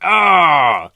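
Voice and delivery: sudden loud high yelp of pain; pained cry. Retold or imitated. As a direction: cry out as the ball hits your head. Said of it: "and I'm like".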